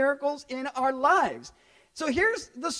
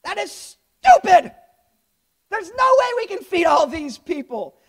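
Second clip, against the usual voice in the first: loud, much higher "Come on, man", yelled in disbelief; outraged shout; 7 semitones higher, 8 dB louder than usual. Retold or imitated. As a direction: blow up at an impossible request. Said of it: "He's saying".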